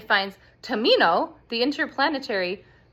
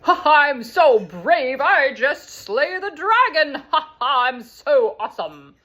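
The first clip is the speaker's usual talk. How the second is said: higher, louder bragging laugh-talk; boastful show-off; 6 semitones higher, 6 dB louder than usual. Retold or imitated. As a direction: brag like a silly fake hero. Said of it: "he's like".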